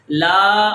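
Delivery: لا held long and loud; long vowel. Shown as a demonstration. Teacher models the reading, لا drawn out. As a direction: stretch the aa of لا. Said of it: "اس کو ہم لمبا کریں گے".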